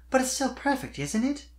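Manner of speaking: pressing question. A narrator's reading. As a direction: push gently for a yes. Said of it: "Sweetie pressed".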